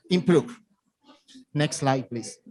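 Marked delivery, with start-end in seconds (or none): none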